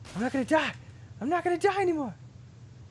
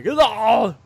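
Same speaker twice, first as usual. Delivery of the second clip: put-on German accent; 7 semitones higher, 13 dB louder than usual. Reading German game text.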